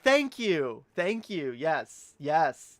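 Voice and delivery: loud, high, gushing thanks; emphatic gratitude. Retold or imitated. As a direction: thank them loudly, swooping down from a high pitch. Acted delighted reply to teasing.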